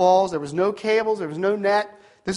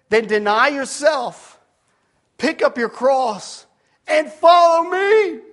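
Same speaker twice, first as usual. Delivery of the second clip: slow, raised declaration building to a shout; emphatic preaching; 7 semitones higher, 6 dB louder, slower than usual. Retold or imitated. Preacher quoting Jesus' call to disciples, slow building command. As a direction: build phrase by phrase to a high, loud command.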